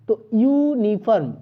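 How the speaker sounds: ordinary delivery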